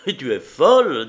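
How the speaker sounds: loud, cutting rebuke; a loud, cutting snap; sharp retort. A narrator's reading. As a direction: cut her off sharply. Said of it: "dit-il d'un ton tranchant".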